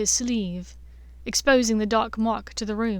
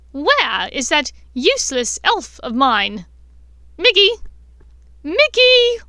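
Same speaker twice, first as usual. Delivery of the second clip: loud calls rising much higher; loud high calling; 6 semitones higher, 9 dB louder than usual. Acted. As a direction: shout for the elf across the house. Bellatrix calling her elf.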